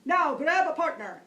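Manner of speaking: loud barking instructor's command; barked order. Retold or imitated. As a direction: bark it like a drill sergeant. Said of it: "begins to bark".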